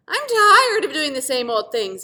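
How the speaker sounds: grumbling. Acted. The Pentagon complaining to itself, loud grumble. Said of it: "it grumbled".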